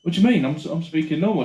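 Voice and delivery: put-on Geordie accent. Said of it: "speaking like a Geordie".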